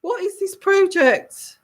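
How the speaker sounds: very high, urgent royal protest; hammed-up alarmed monarch calling a halt; the Queen. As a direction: cut in repeatedly in a high, imperious voice. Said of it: "the Queen is saying".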